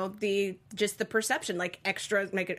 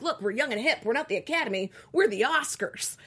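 put-on higher, eager young-and-hip voice; trying to be young and hip; 5 semitones higher, 3 dB louder than usual. Academy rebranding itself as the Oscars, trying-too-hard hip voice. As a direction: put on a pitched-up wannabe-hip voice. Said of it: "That was the young and hip voice".

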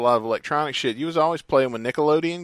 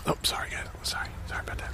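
soft apologetic whisper; ASMR whisper; 11 dB quieter, faster than usual. Retold or imitated. ASMR parody.

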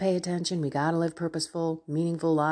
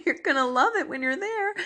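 excited; 9 semitones higher, 3 dB louder than usual. Real, not acted. Encouraging viewers, emotional.

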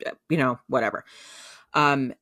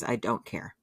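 low, firm; 5 semitones lower, 6 dB quieter than usual. Retold or imitated. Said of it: "And he says".

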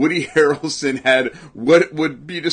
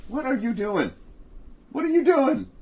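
high-pitched questioning; 14 semitones higher than usual. Real, not acted. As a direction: voice leaps way up, falling at the end. Breaks off to question the cat.

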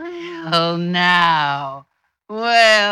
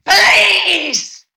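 shout; 11 semitones higher than usual. Acted. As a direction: scream it in alarm. Beth screams for police.

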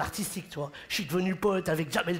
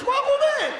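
excited boast; 7 dB louder than usual. Real, not acted. Boasting, very high and loud.

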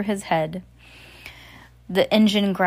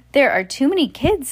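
alarmed; 7 semitones higher, 5 dB louder than usual. A narrator's reading. Panicked driving scene.

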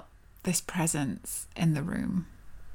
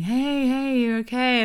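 lightly voiced sleepy reassurance, pitched up; half-asleep boyfriend; 5 semitones higher, 3 dB louder, slower than usual. Boyfriend waking beside screaming girlfriend, half-asleep. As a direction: imitate a groggy partner checking on her. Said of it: "woke up half asleep".

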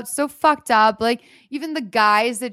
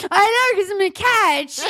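high mock sob-boast; 9 semitones higher than usual. Kristen crying outside the bar to James, high and wailing. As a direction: wail it up high through fake tears. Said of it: "She's like".

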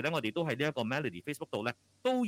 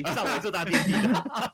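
laughing; 8 semitones higher, 7 dB louder, slower than usual. Real, not acted. Host laughing at own remark.

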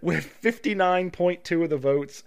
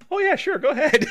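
much higher, louder breezy okay; mock chill rep; 13 semitones higher, 6 dB louder than usual. Host imitating the easygoing old Amazon, high and breezy. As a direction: toss it off high and carefree.

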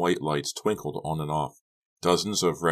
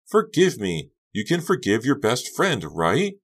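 desperate pleading; 8 semitones higher, 5 dB louder than usual. A narrator's reading. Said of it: "pleaded with growing desperation".

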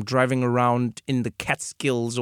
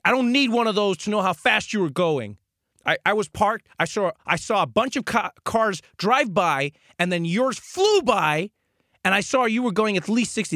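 indignant cop; 7 semitones higher than usual. Retold or imitated. Cop to driver, defending his guess, indignant. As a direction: lecture him like an offended cop.

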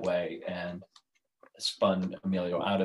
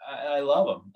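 excited; 9 semitones higher, 7 dB louder than usual. Real, not acted. Excited about team colors.

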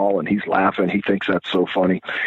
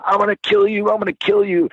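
mock furious threat; 10 semitones higher than usual. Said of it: "He's screaming".

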